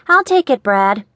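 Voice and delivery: high, eager and pinched. Acted. Gretchen eagerly grabs handkerchief.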